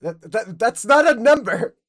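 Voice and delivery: loud indignant shout; loud disbelieving yell at a friend. Real, not acted. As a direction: shout it in protest. Arguing with friend over game.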